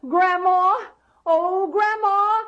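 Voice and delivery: high, loud call. Acted. Jack calls up to Grandma inside the giant's head, high and loud. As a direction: shout up to her far away. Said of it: "And Jack called".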